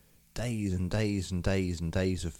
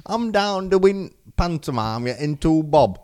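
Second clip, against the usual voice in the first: slow, higher, louder put-on British grumble; mixed-up British accent; 8 semitones higher, 7 dB louder, slower than usual. Brother mocking Charlie's pantomime days, hammed-up British accent. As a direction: drag it out in a muddled regional accent. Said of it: "We've got accents all over the place".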